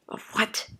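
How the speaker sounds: whispered question; hushed whisper. Acted. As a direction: whisper it in disbelief. Hushed exchange.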